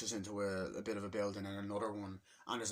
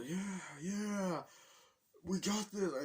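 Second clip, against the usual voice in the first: high Koopa hype; 9 semitones higher, much slower than usual. Retold or imitated. Koopas gathering, retold.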